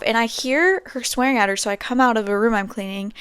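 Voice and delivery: ordinary talk, nothing marked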